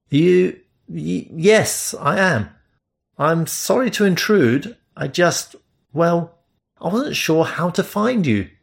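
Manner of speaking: nervous Englishman caught off guard; lightly voiced, stumbling apology; flustered stammer. Flustered narrator answering stranger.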